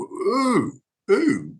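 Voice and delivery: high mock wince; cringing reaction. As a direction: imitate recoiling from nails on a board. Acts out wincing at bad singing.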